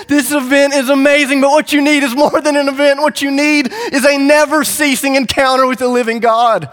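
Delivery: high, loud cry to a crowd; impassioned proclamation. Acted. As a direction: proclaim it over a festival crowd. Jesus calls out over the crowd.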